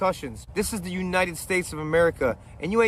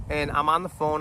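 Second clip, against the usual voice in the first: normal talking voice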